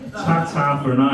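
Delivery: slow posh phrase; posh English accent. Retold or imitated. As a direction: say it slowly, a little too posh. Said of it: "I said that shit out loud".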